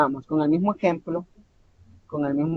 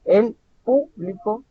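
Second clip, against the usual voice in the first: slow, higher "El público", PÚ stressed; stress on first syllable; 5 semitones higher, slower than usual. Shown as a demonstration. Teacher repeating the exercise, first syllable of "público" stressed. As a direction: hit PÚ, then let "público" fall. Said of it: "vamos a hacer el ejercicio nuevamente".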